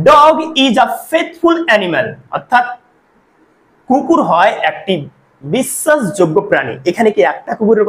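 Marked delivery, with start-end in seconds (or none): 0.1-2.1 very slow, every word drawn out; slow dictation of an example; slow demo sentence. Shown as a demonstration. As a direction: read the example slowly to students. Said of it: "এখানে কি বলছে দেখো".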